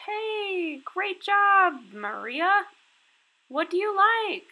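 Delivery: enthusiastic teacher praise. Shown as a demonstration. Teacher praising student Maria at lesson end, high and loud. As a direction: gush over the student.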